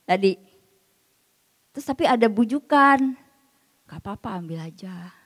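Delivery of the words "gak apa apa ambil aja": whispered coaxing, soft and sly; soft tempting whisper. Acted. Inner tempter coaxing a listener to steal, whispered. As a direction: breathe it like a gentle temptation. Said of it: "Ah, suaranya lembut".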